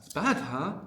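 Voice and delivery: "bad" high, with "huh?" rising at the end; a high, emphatic "It's bad", lifted on "huh?". Shown as a demonstration. Teacher repeats the phrase to be translated, emphatic. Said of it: "it's really emphatic there".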